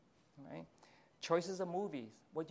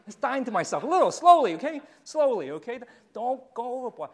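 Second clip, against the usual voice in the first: much louder and higher, half laughing; loud emphatic urging; 7 semitones higher, 15 dB louder than usual. Real, not acted. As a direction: drive it home with a burst of force. Preacher urges the congregation, laughing.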